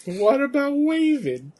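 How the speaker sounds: high, slow, drawn-out imitation; mock plaintive catchphrase. Echoing a friend's wrestling impression, slow mock plaintive wail. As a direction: echo the wail in a put-on high voice.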